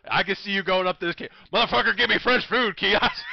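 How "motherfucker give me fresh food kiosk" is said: high, loud mock yell at the kid. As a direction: ham up a yell demanding fresh food. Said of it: "yelling at the fucking kiosk".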